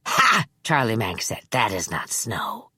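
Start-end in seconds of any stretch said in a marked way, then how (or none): 0.1-0.4 sharp high scoffing "Ha"; scoffing laugh. Acted. Manx scoffs. 1.5-2.7 put-on low, hushed sinister old man; a creepy, softly menacing elder. Manx's held voice.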